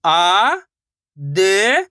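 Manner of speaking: आ, द, each drawn out alone; syllable-by-syllable sounding out. Shown as a demonstration. Teacher asks learners to copy, आ and द pronounced singly. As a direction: stretch आ, pause, stretch द. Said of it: "एक एक अक्षर का उच्चारण करके".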